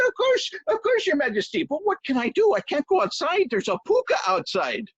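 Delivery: very high, panicked pleading; panicked servant. Acted. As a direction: scramble for an excuse.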